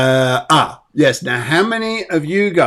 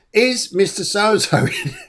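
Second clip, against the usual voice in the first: higher, breaking into laughter mid-question; laughing; 5 semitones higher than usual. Real, not acted. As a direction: try to ask it through a laugh. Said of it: "Sorry, I'm laughing".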